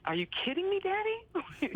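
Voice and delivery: high, incredulous; disbelieving, teasing her dad. Retold or imitated. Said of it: "And I'm like".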